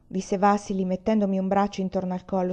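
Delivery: ordinary delivery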